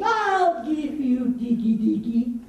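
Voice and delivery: loud, slow threatening roar; lion's roar. Acted. Lion bursts in on dancing monkeys, loud slow roar. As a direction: draw it out loud as an angry lion.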